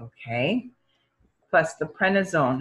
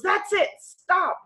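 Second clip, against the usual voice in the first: commanding dad; slower than usual. Retold or imitated. Dad stops the procedure.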